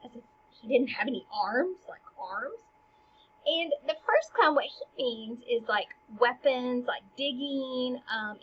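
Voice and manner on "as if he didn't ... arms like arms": ordinary talk, nothing marked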